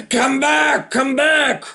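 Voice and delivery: shouted call to come back. A narrator's reading. Brother calling the boy back, shouting. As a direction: call him back across the beach.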